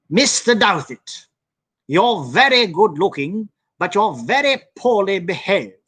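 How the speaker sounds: mock English professor scolding a late student; posh English professor. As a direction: drawl it out like a prim English lecturer scolding. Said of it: "just the way he said it".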